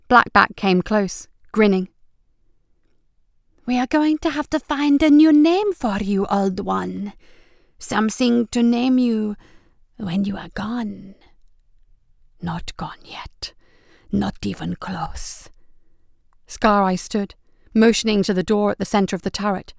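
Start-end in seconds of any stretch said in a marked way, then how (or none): 3.7-11.1 sly, gloating beast taunt; menacing creature voice. Acted. Shark creature taunting wounded rival. 12.4-15.5 softer, slow, defiant whisper; hoarse whisper. Acted. Wounded Scar Eye defies rival.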